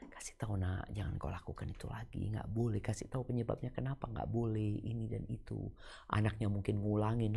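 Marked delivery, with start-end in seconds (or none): 0.1-5.7 soft private correction. Retold or imitated. Parent gently corrects child privately.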